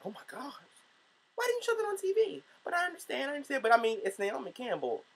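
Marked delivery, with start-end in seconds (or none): 0.1-2.4 high, hushed gasp of disbelief; shocked disbelief. Retold or imitated. Vlogger hearing Oprah cut the ugly cry, high hushed disbelief. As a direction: gasp it like you were robbed of the footage. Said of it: "And I'm like".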